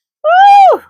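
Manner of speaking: high loud whooping woo; woo exclamation. Acted. Seeing all her kids come out, loud whoop. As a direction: whoop in shock.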